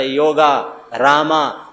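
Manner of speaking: યોગા and રામા with a long final a; added final a. Shown as a demonstration. Preacher shows how foreigners say યોગા, final a added. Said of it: "એ પાછળ બધા આકાર લગાડે".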